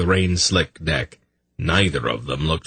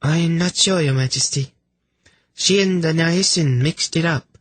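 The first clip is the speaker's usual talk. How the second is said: very high, sweet female voice; high-pitched, politely innocent messenger; chirpy lady; 11 semitones higher than usual. Acted. Lady offering a drink to sufferers, sweetly chirpy. Voices Prala answering the king.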